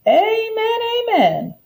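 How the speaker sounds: high ringing church-style amen; chorused amen. Acted. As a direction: passengers answering like a congregation. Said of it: "chorused the passengers".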